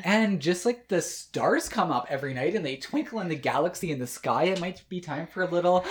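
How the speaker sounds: dramatic build-up. Acted. Building up to the show jingle.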